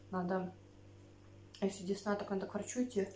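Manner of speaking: speaking between bites of salad; talking while chewing. Real, not acted. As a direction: mouth partly full of food. Seen 4: Seaweed taken to mouth at -1.0; lips closed, seaweed held throughout.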